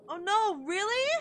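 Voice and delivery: high, loud, pleading disbelief; wailed "oh no"; dismayed disbelief. Real, not acted. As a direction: cry out in dismay, rising to a question. Dismayed discovery in the game.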